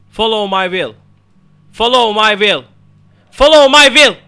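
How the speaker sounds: from firm to a full high yell; building to a shout. Real, not acted. Gamer yelling the spell again and again at the game, building to a shout. Repeating spell louder into mic.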